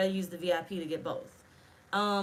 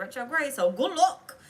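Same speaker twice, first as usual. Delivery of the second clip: higher and louder, hyped up; 4 semitones higher, 7 dB louder than usual. Real, not acted. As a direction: burst out with excitement. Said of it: "I got a little bit too hyped there".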